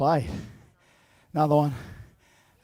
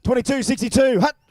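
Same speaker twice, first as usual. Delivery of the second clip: higher and louder snap count, barked out; loud mock quarterback count; loud quarterback call; 6 semitones higher, 7 dB louder than usual. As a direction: shout the snap to the field. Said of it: "So we'll try again".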